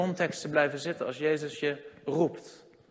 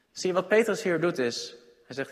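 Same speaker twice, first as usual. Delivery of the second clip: ordinary delivery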